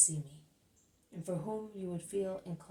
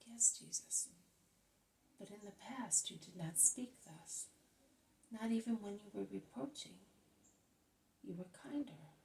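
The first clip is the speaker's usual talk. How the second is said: hurt whisper; 3 semitones higher, 4 dB quieter than usual. A narrator's reading. Hurt cousin reproaches Jesus.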